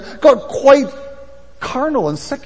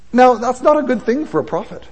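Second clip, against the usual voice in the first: ordinary delivery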